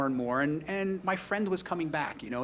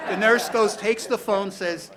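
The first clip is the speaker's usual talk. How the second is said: laughing; 6 semitones higher, 9 dB louder, slower than usual. Real, not acted. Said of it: "laughing while telling".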